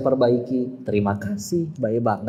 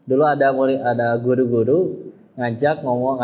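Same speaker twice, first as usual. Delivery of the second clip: ordinary delivery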